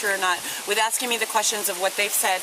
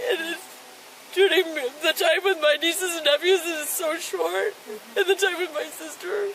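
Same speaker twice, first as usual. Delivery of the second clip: crying; 7 semitones higher than usual. Real, not acted. Breaks down talking about family.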